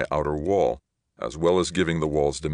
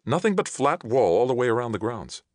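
higher-pitched, firm character reply; emphatic; 7 semitones higher than usual. A narrator's reading. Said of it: "she was emphatic".

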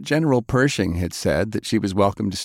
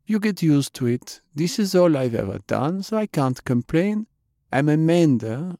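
put-on higher, resigned young voice; matter-of-fact young woman; 5 semitones higher than usual. Jane telling Faye she can't complain, higher and resigned. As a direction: shrug it off lightly. Jane explains being a mender.

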